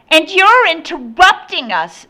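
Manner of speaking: put-on high, loud bratty child; indignant child's voice. A narrator's reading. Boy to the man at the door, loud and indignant. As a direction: accuse him loudly as a child.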